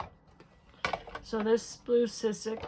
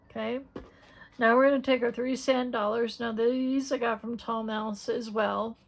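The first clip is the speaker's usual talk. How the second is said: ordinary talk, nothing marked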